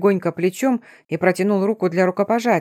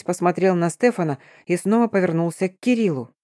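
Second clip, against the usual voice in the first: plain reading voice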